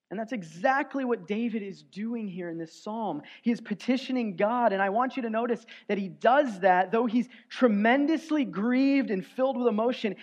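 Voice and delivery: ordinary talk, nothing marked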